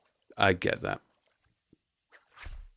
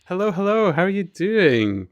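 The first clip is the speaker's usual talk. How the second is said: excited greeting; 8 semitones higher, 5 dB louder than usual. Real, not acted. Greeting a chat viewer.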